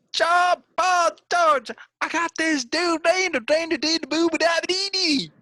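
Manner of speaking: country Southern Beatle. Acted. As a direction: squawk it like a hammed-up country Beatle. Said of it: "a country Southern [name] group".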